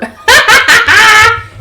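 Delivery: loud, high cackle; mocking laugh. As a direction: burst out laughing at his fear.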